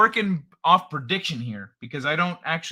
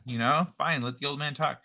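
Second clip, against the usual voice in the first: ordinary talk, nothing marked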